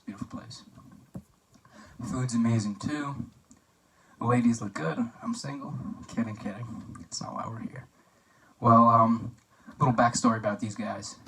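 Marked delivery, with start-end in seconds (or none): 0.1-0.6 whispered aside. Real, not acted. Muttered aside after praising decor. 6.1-7.9 whispered aside. Real, not acted. Joke taken back as an aside.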